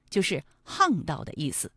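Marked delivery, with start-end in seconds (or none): 0.7-1.2 巷道 read as hàngdào. Shown as a demonstration. Host explains the word for this reading, 巷道 as hàngdào. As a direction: say 巷道 with an h sound, hàngdào. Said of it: "还有一个读音啊是巷".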